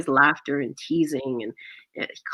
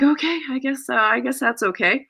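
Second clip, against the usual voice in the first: high, uncertain going-along; acted nervous giving-in; hesitant acceptance; 7 semitones higher than usual. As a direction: agree doubtfully, voice up high. Acts reluctant, nervous acceptance.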